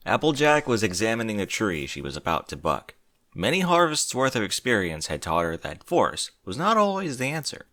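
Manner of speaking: ordinary delivery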